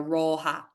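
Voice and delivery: rótha with a drawn aw; long aw. Shown as a demonstration. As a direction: stretch the aw in rótha. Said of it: "hear that aw there".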